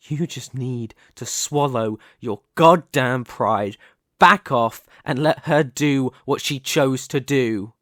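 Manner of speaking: loud, emphatic ultimatum; forceful demand. Acted. Telling a rival family leader to back off, forceful. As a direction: press each point hard and loud.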